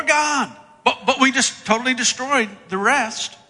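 high, loud stammered excuse; hammed-up self-justifying plea; defensive excuses. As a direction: shift the blame, pitch climbing. Acts Saul making excuses to Samuel.

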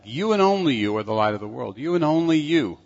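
"You and only you" driven home, rising in pitch on the repeat; very emphatic. Retold or imitated. Preacher repeating Jesus' words for listeners, "You and only you" very emphatic. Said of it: "Very emphatic".

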